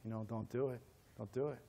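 low, soft urgent warning; a friend's hushed don't do it. Retold or imitated. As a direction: quietly warn him off. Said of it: "Billy's like".

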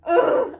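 loud high disgusted groan; scared groan. Real, not acted. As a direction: groan out in fright. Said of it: "I'm so scared".

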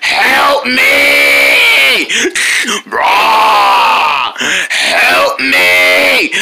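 loud desperate screaming; terrified cry for help. Acted. Screaming victim.

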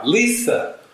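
a high rising call of the name Lisa. Retold or imitated. The light calling Lisa by name, high rising call.

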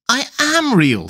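high-pitched tearful protest, mildly acted; close to tears. A narrator's reading. Alice insisting to Tweedledum, high tearful protest. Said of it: "and began to cry".

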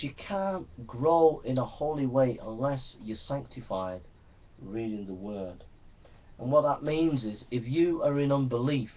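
ordinary talk, nothing marked

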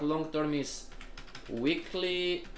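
ordinary talk, nothing marked

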